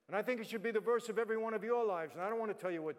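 preacher urging the crowd; preaching. Acted. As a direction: call out to the whole room. Preaching to audience.

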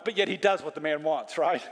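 ordinary delivery

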